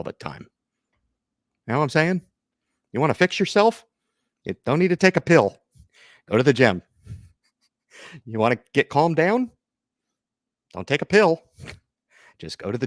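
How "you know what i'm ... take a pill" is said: higher, louder gym sermon; pep rant. Real, not acted. Urging viewers to hit the gym, loud and higher.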